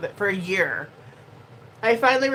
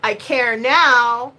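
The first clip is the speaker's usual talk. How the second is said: slow, loud, each word leaned on; I care now, drawn out and loud; slow emphatic; 3 semitones higher, 8 dB louder, much slower than usual. Acted. Streamer announcing she finally cares, slow and loud. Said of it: "finally cares after a year".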